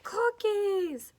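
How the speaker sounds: loud, high, stretched-out shout; gleeful book character. Acted. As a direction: read it out with huge excitement. Voices book's gleeful character line.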